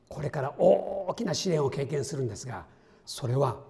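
normal talking voice

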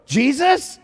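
high, rising, astonished; amazed question. Retold or imitated. Retold amazed question after gasp.

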